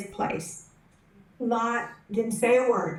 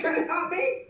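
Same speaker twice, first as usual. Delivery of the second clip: eager pleading; 9 semitones higher, faster than usual. Retold or imitated. Repeats plea, laughing.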